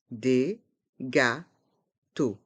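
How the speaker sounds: slow "Des gâteaux", a gap between syllables; learner-paced, split "Des gâteaux". Shown as a demonstration. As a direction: separate each sound of "Des gâteaux". Teacher breaks phrase into syllables.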